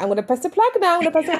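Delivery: put-on high teasing kid voice; mock naughty kid. Kid at the plug, looking back, high teasing child voice. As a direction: tease like a toddler at the plug. Voicing a defiant toddler.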